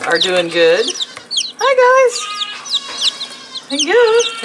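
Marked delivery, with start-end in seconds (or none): 1.6-4.4 very high squeaky greeting. Real, not acted. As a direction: squeak hello up at the chicks. Greeting the chicks in baby talk.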